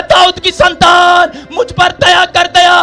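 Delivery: drawn-out shouted plea for mercy; crying out loudly. Acted. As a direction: shout it out like a desperate beggar. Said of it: "जोर जोर से चिल्लाने लगा". (ज़ोर-ज़ोर से चिल्लाकर)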